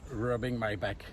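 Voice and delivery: normal talking voice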